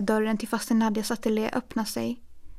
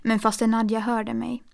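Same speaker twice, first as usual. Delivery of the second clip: ordinary delivery, nothing marked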